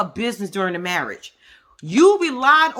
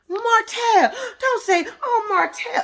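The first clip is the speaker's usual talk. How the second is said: mock Mel voice; 12 semitones higher, slower than usual. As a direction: squeak it out, mocking her. Quoting Mel scolding Martell.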